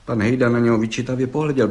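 ordinary delivery

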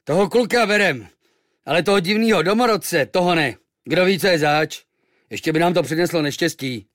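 loud, high-pitched gruff-tempered verdict; dismissive sailor. A narrator's reading. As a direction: reject the stranger loudly, with suspicion. Sailors reject the stranger. (hlasitý, odmítavý námořník)

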